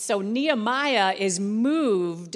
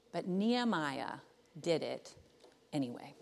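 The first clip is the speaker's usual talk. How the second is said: hushed emphatic point; 6 semitones lower, 10 dB quieter than usual. Acted. Dramatic closing point.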